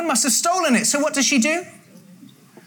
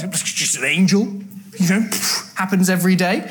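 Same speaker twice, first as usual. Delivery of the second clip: lower, offhand dismissal; unperturbed; 6 semitones lower than usual. Acted. Mary not even perturbed by an angel, acted nonchalance. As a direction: toss it off with a puff, mock indifference. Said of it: "not even perturbed in the slightest".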